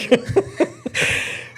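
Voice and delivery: high, airy real laugh; breathy laugh. Real, not acted. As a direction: laugh at the jab. Laughs at broke dog joke.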